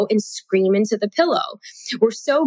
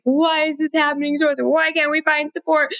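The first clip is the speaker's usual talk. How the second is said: higher, fast, lightly imitated tearful wail; retold crying; 7 semitones higher than usual. Mother retelling how she broke down when the sitter cancelled, a mild mock tearful lament. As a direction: replay the despair of the moment, half-mocking. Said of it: "I start crying".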